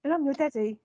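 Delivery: high, playful put-on voice; mock actor introduction. Host posing as Oscar Isaac, high put-on voice. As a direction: introduce yourself as a jokey put-on star.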